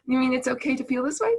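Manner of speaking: surprised emotion. Pretend. The emotion voiced as a child.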